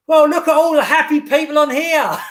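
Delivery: mock cheerful shout on a silent train; cheeky shout. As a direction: tease the gloomy commuters out loud. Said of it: "so I just shouted out".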